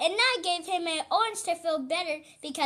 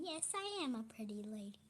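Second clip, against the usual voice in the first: playful whisper; 6 semitones lower, 12 dB quieter, faster than usual. Pretend. Daughter showing off for camera.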